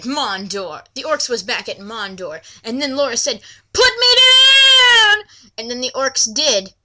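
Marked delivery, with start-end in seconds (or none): 3.7-5.2 high, loud, slow shriek; mock yell. Laura yelling at the orcs carrying her, high and loud.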